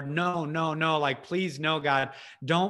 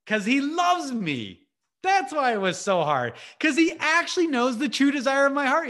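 passionate crescendo; 9 semitones higher, 5 dB louder than usual. Real, not acted. Preacher climaxing on God's love.

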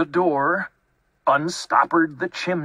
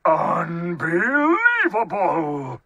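slow, raised, drawn-out outburst; exasperated disbelief; 4 semitones higher, 3 dB louder, slower than usual. A narrator's reading. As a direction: stretch the word in outrage. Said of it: "rumbled Bear".

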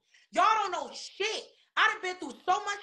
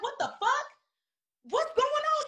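retold alarmed reaction; 10 semitones higher than usual. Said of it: "I'm like".

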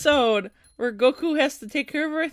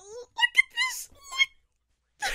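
excited squeal; 5 dB quieter than usual. Real, not acted. Fan squealing at anime scene.

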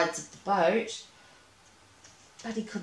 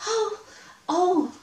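very high, louder panicked oh oh; excited mouse shout; 12 semitones higher, 6 dB louder than usual. Acted. Mouse shouting as the fish fights, very high and loud. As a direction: yelp as the fish pulls hard. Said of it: "Nathan shouted".

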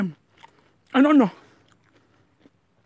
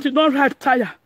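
usual speaking voice

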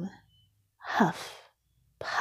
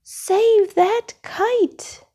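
much higher, slow, stretched call; urgent shout; 13 semitones higher, 3 dB louder, slower than usual. Acted. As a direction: yell after the falling kite. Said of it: "shouted Mole".

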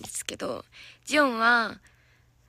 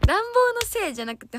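higher, louder, quick denial; emphatic protest; 10 semitones higher, 5 dB louder, faster than usual. Real, not acted. Idol protesting to viewers in a higher, louder, quicker voice. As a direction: insist it's really not that. Protesting it's not the heater.

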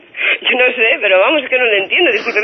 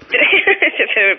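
a burst of helpless laughter; short real laugh. Real, not acted. Operator to prank caller, short helpless laugh. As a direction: laugh out at the absurd answer. Reacts to nonsense caller reply.

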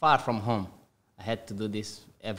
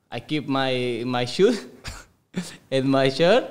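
ordinary talk, nothing marked

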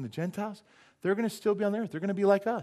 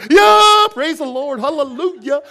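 much higher and louder yelps of praise; hammed-up frantic praise; 11 semitones higher, 17 dB louder, slower than usual. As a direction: overplay panicked worship with hands up. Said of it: "You would be".

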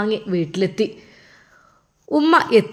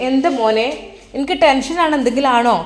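higher and louder, worried; anxious mother; 4 semitones higher, 4 dB louder than usual. Acted. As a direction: press her son, tense. (ടെൻഷനോടെ മകനോട് ചോദിക്കുന്ന ഉമ്മ, ഉച്ചത്തിൽ)

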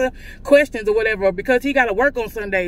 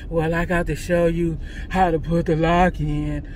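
lower, flat mock of the clerk; dry clerk imitation; 7 semitones lower than usual. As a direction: play the bored clerk explaining. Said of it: "That's how fucking dry he was".

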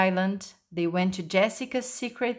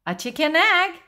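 higher-pitched, surprised echo; puzzled question; 6 semitones higher, 3 dB louder than usual. A narrator's reading. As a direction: repeat it back in disbelief. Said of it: "asked Jessica".

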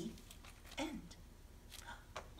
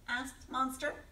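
ordinary delivery

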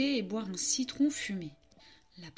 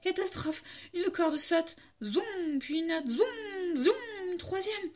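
de plus en plus aigu à chaque zoom; catastrophe montante; 8 semitones higher, slower than usual. A narrator's reading. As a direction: annoncer chaque corde qui casse, affolé. Les cordes cassent une à une. (narration aiguë et affolée)

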